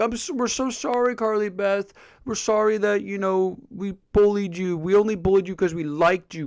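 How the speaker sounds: high-pitched mock apology from the bullies; mock begging apology. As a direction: plead as if on your knees, hammed-up. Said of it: "get on their knees and be like".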